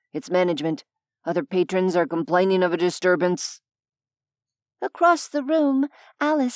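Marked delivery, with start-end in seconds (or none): 0.1-3.6 lower, businesslike hotel manager; muffled male voice. Acted. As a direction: through a closed door, lower. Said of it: "came a muffled male voice".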